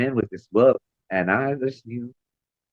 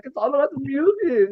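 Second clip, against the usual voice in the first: sing-song; 13 semitones higher than usual. Retold or imitated. High sing-song retold.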